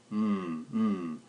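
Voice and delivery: Mm hummed on the vocal cords; short separate hummed Mm sounds; voiced M. Shown as a demonstration. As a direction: hum each Mm as a voiced consonant. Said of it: "Make your vocal cords vibrate".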